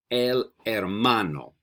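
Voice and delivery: "El hermano" slowly, with the h silent; model pronunciation. Shown as a demonstration. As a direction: say "El hermano" drawn out for learners to repeat, no h sound. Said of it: "I'm gonna say it three times".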